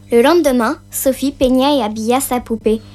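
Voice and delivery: plain reading voice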